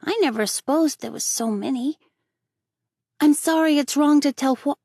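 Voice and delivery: put-on high, loud little boy, pleased with himself; a cheeky boy grinning at his prank; boy's voice. Davy's voice.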